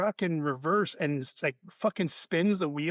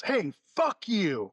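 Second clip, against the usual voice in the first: drawn-out, higher mock yell; slow, pitched-up retold cussing; retold angry heckle; 5 semitones higher, slower than usual. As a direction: imitate a hostile driver cursing, slow and raised. Retold hostile driver yelling from truck.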